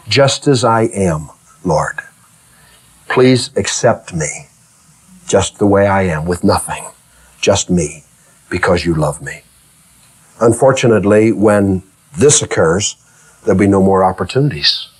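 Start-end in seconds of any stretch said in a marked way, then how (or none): 0.1-9.4 quiet, lower prayerful plea; a gentle whispered-down prayer; soft humble prayer. Retold or imitated. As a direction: pray it softly, surrendering.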